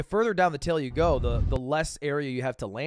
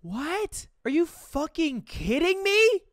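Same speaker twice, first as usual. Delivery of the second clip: stunned disbelief; 14 semitones higher, 3 dB louder, slower than usual. Real, not acted. Disbelief at rival's run.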